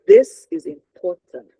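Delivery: "this" punched loud, the phrase slowed down; slow, weighty "this is important", syllables of "important" set apart; stressed, not shouted. Shown as a demonstration. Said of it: "I'm not saying shout".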